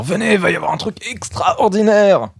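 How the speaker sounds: high, eager crowd call; excited calling. Acted. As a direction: hype up passers-by. Calling people over.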